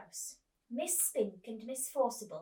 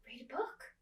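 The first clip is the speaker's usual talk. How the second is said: whisper; 4 semitones higher, 5 dB quieter than usual. Acted. Cosy reading aside to kids.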